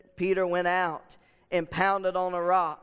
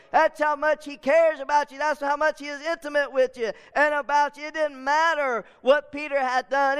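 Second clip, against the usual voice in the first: full-force preaching; 6 semitones higher than usual. Acted. Preacher pressing the congregation, full-force preaching.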